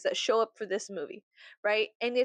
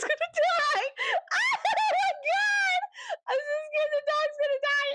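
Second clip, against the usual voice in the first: very high, drawn-out hammed-up wail; mock crying. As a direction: sob it out in exaggerated terror. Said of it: "crying in the bathroom".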